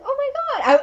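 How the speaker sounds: high, loud shocked gasp. Retold or imitated. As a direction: blurt it out in total shock. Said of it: "I was honestly shocked".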